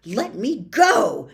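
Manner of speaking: put-on loud, slow snarl of a struggling boy; angry snarling shout. A narrator's reading. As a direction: snarl it while tugging free. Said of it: "Harry snarled".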